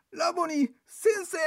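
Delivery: Mary crying out to Jesus on recognising him; crying out to her teacher. Retold or imitated. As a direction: call out as if grabbing hold of him. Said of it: "ってしがみつこうとしたんや".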